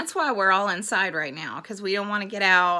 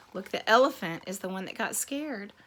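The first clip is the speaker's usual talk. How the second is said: usual speaking voice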